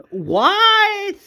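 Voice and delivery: high, rising incredulous what; outraged disbelief. Retold or imitated. Outraged reaction to Professor X dying.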